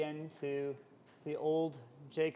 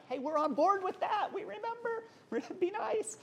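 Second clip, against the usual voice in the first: much higher, pleading, ending in a laugh; mock brothers' voice. Preacher mimics brothers fawning to Joseph, high and pleading. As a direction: play the nervous brothers sucking up.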